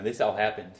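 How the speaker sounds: ordinary talk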